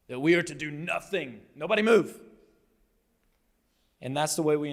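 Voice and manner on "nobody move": loud, raised "freeze" order, tongue in cheek; mock command. Preacher mocking the idea of rest as stillness, in a loud mock command.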